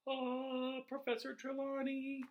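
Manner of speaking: high, far-off adoring gasp; mock worship. Reader mocking students who revere Trelawney, gushing. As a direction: swoon over her like a devoted fan. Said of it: "like, this almighty figure".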